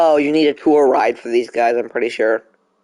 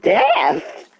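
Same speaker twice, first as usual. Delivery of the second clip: high, questioning retry of the cracked word; repeated voice crack; 5 semitones higher than usual. Shown as a demonstration. Streamer repeating the word that cracked, high and questioning. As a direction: say it back high, testing the crack. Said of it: "my voice cracked so badly when I said death".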